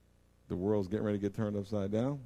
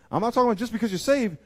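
ordinary delivery

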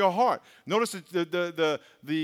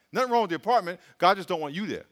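ordinary talk, nothing marked

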